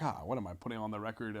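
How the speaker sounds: ordinary talk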